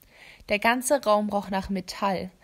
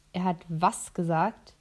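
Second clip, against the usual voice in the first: slow, stunned disbelief; surprised; 3 semitones lower, slower than usual. Acted. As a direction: caught off guard, asking slowly. Jace nearly drops the blade.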